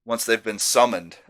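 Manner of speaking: ordinary delivery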